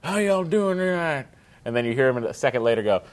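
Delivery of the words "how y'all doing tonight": higher put-on singer's mic voice. Imitating a singer on mic to the crowd, put-on stage voice. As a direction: imitate a frontman greeting the crowd. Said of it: "what is that voice?".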